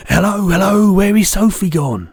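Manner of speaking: high, loud searching call in a giant's voice; shouting. Acted. BFG imitating parents searching for Sophie, shouting high. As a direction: call out for a missing child.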